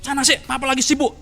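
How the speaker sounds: lightly voiced father waving his child away; dismissive busy father. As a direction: brush the kid off, impatient and offhand. (ayah sibuk mengusir anaknya, acuh tak acuh)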